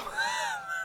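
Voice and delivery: high, soft squeal of laughter; breaking into high-pitched giggles; helpless laugh. Real, not acted. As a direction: crack up at your own bad accent. Cracks up at own accent attempt.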